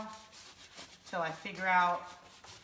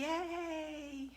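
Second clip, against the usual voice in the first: soft, high, drawn-out yay; mock muted little cheer; imitating understated excitement; 7 semitones higher, 9 dB quieter than usual. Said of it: "understated. It's just like".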